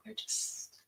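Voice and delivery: breathy whisper in the scene; whispered line. Acted. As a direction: drop to a whisper mid-thought. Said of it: "can we reenact that?".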